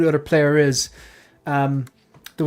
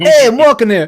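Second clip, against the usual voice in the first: loud, high New York Italian; "I'm walking here" with a laugh, New York Italian; New York Italian accent; 8 semitones higher, 8 dB louder than usual. Pretend. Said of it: "Italian shit".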